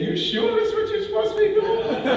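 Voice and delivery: high, amused imitation of his doubtful wife; lightly mock her teasing doubt with a laugh. Speaker imitating his wife questioning his career choice, amused and doubtful.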